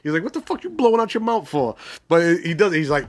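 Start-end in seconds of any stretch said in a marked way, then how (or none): 0.2-1.8 high-pitched, indignant mock challenge; imitating Avon's call-out. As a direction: act out calling someone out, pitched well up. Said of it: "actually calls him out for it".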